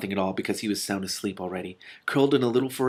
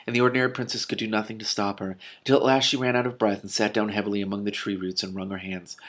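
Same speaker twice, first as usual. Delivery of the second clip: ordinary delivery, nothing marked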